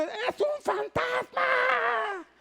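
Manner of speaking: "¡Es un fantasma!" very high, drawn out, breathy and soft; terrified cry. Shown as a demonstration. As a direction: cry it out stretched and high like a spooked disciple. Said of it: "gritaron pavorosamente". (grito de espanto agudo y alargado)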